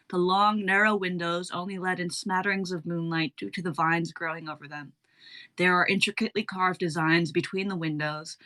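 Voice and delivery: ordinary delivery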